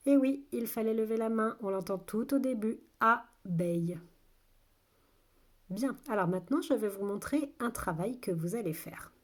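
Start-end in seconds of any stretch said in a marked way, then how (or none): 3.0-4.0 two well-separated syllables. Shown as a demonstration. Teacher repeats the word for listening children, syllable by syllable. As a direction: sound it out with a gap between beats.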